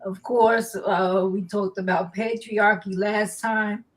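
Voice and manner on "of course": ordinary talk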